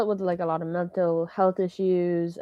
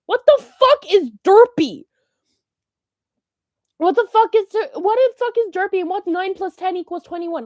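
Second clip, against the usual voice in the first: exasperated rant; 10 semitones higher, 7 dB louder than usual. Real, not acted. Ranting about memes loudly.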